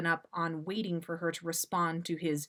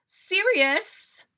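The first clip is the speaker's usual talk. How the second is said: high, loud call of his name; 12 semitones higher, 7 dB louder than usual. Acted. Molly calling Sirius back to the kitchen, loud call. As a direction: call him back sharply.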